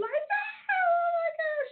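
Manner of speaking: breaking into tears, high and slow; crying for real. Real, not acted. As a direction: voice cracking into a sob. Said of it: "I start getting emotional".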